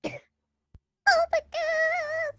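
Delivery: high, slow, loud shriek; excited scream. Real, not acted. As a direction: squeal with delight into the mic. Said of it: "Jesus, right in my ear".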